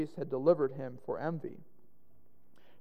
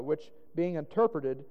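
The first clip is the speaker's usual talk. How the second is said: usual speaking voice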